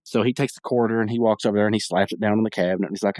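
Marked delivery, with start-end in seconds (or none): none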